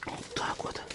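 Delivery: soft unvoiced whisper. Real, not acted. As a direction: whisper it to yourself. Stirring bait, under breath.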